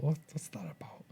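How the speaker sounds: whispered under the breath; bemused whisper to yourself; whispered thought. Retold or imitated. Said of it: "I just thought".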